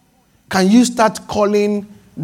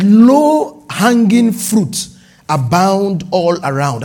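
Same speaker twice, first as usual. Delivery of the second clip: solemn slow reading; 3 dB louder, much slower than usual. Shown as a demonstration. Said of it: "I'm reading now, just as God ... spoke to me".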